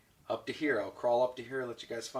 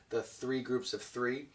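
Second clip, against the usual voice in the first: normal talking voice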